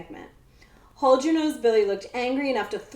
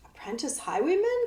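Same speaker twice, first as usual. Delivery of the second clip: scoffing; 6 semitones higher, 3 dB quieter than usual. A narrator's reading. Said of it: "Captain Itz scoffed".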